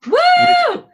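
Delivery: high, loud celebratory woo; excited whoop. Real, not acted. Co-host cheering his friend's PhD acceptance, loud high whoop. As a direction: cheer the big news.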